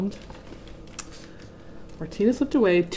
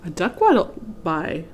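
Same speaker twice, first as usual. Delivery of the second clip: ordinary delivery, nothing marked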